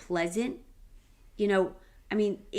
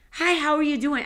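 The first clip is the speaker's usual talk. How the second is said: bright, higher friendly hello to a passer-by; upbeat street hello; cheery greeting; 6 semitones higher, 4 dB louder than usual. Retold or imitated. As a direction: greet a stranger with a lift in the voice. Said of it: "I'll be like".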